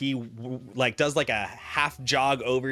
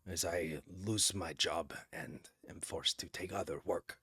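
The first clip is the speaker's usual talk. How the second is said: much softer, near-whispered grumble; muttered aside; 5 semitones lower, 11 dB quieter than usual. Acted. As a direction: grumble it almost under your breath. Kraus grumbles about his job.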